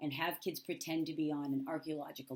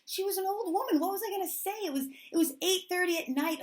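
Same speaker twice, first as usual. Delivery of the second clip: much higher, louder, exasperated protest; 12 semitones higher, 7 dB louder than usual. Retold or imitated. To her roommates, protesting she could say nothing: high exasperated plea. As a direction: throw up your hands defending yourself.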